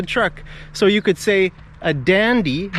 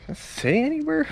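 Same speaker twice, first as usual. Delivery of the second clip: high puzzled question; 12 semitones higher, 5 dB quieter than usual. Real, not acted. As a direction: voice jumps up, asking aside. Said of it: "asking someone off camera".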